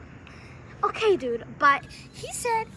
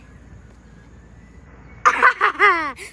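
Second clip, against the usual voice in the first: big mock-evil cackle; evil laugh; 11 dB louder than usual. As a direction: cackle building to a loud burst. Villain laughs at killing everybody.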